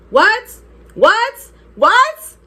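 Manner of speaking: loud, high repeated challenge; heated challenge. Retold or imitated. Girl cussing out her cheating boyfriend, heated. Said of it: "I was heated".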